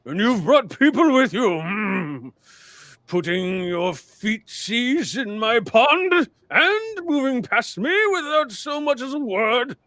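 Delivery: a huge ancient mountain, high and loud, drawn out; slow booming mountain spirit. Acted. The mountain spirit catches Ameep sneaking past, slow and booming. GM voices the Whispering Man.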